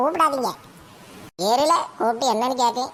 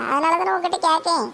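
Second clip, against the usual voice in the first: ordinary talk, nothing marked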